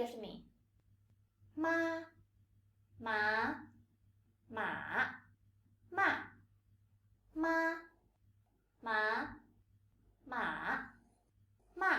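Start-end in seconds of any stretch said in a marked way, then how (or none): 1.6-6.2 "ma" in four tones, drawn out with pauses; four tones slowly. Shown as a demonstration. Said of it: "this time slowly". 7.3-11.9 "ma" in four tones, drawn out with pauses; four tones slowly. Shown as a demonstration. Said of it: "this time slowly".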